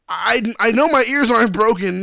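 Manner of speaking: usual speaking voice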